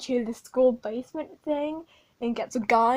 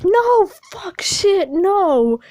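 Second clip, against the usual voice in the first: much higher, louder frantic shout; panicked yell; 7 semitones higher, 11 dB louder, much slower than usual. Retold or imitated. Veronica stopping Martha at the party, frantic shout. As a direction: yell it to stop the piñata. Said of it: "And Veronica's like".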